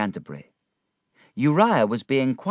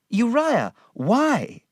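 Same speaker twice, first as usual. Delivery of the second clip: high insistent question; 4 dB louder, much slower than usual. A narrator's reading. David demands to know why.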